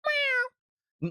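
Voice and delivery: high, stretched-out cat meow. Pretend. Streamer meowing for chat, high cat sound. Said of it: "you just have to tell me to meow".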